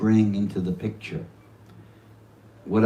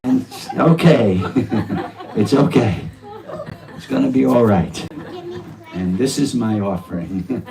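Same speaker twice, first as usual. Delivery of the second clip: loud, laughing cry to the Lord; laughing prayer; 3 semitones higher, 5 dB louder than usual. Real, not acted. Devotee jokingly calling out to Nrsingadev, laughing loudly. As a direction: shout the name out through laughter.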